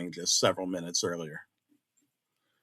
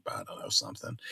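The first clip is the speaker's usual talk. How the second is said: whispering; 5 semitones lower, 12 dB quieter, faster than usual. Real, not acted. Trailing aside whispered.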